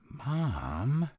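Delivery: high-pitched, embarrassed protest; exasperated plea. Acted. Embarrassed child shushes mom during the call, exasperated. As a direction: cut in, mortified at your parent.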